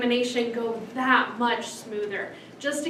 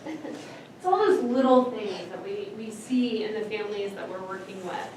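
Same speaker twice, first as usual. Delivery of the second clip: ordinary delivery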